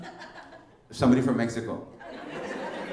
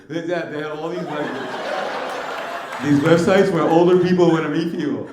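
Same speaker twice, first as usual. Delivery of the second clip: higher, mock kid voice, a little breathless; imitating his young son; 4 semitones higher than usual. Comic dad imitating his son as a young boy, higher, mock kid voice. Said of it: "this is the way he talked when he was younger".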